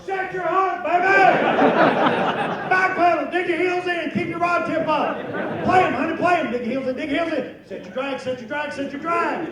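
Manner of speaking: high, loud frantic shouting instructions; yelled coaching. Retold or imitated. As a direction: holler at her across the pond to fight the fish. Yelling coaching to wife.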